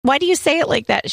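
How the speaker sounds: put-on high, quick childish voice; imitating a child. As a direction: play a puzzled little kid asking mom. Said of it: "I go".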